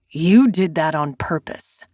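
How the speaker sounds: a hint of low accusing male voice; lightly voiced angry man. Zane accusing the narrator, lightly voiced low anger. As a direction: accuse her, low and tight.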